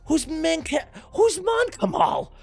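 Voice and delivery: very high, loud commentary at fever pitch; race play-by-play. Pretend. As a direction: shout the surprise finish like a stunned sportscaster. Live race climax.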